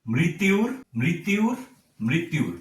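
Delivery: মৃত্যুর as 'mrit-iyu-r', first two rising, last falling; full pronunciation drill. Shown as a demonstration. Teacher drills the complete word, মৃত্যুর repeated as a model. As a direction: repeat মৃত্যুর carefully, sounding the final r. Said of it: "এটির সম্পূর্ণ উচ্চারণ হবে".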